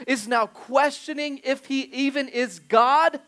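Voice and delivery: loud, high-pitched preacher delivery; forceful preaching. Acted. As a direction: drive the point home to the congregation.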